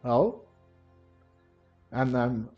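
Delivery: normal talking voice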